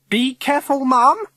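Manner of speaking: very high, loud alarm; crying out. A narrator's reading. Governor warning the head about visitors, crying out. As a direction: cry out a scandalised warning. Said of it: "cries Missus Summers".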